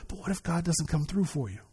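soft, sly whisper of doubt in the ear; whispering Satan. Retold or imitated. Satan whispering doubt into a believer's ear, hushed and sly. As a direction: breathe the doubt softly like a tempter. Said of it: "whisper in your ear".

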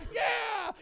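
high shouted cheer; leaping up at a Chiefs touchdown; touchdown celebration. Retold or imitated. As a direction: yell it like the team just scored.

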